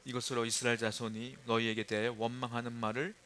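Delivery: soft, even Bible reading; scripture reading. Acted. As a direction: read the verse quietly and evenly. Reads scripture aloud. (조용히 성경 봉독)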